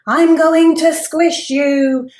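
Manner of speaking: loud menacing villain leaf; evil leaf. Pretend. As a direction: loom over the worm. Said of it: "I'm an evil leaf".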